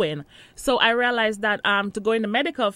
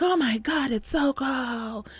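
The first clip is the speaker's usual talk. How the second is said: drawn-out, mock-aghast exclamation; retold dismay; 4 semitones higher, slower than usual. Newcomer retelling the first shock of cold, dismayed. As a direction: stretch it out in playful disbelief.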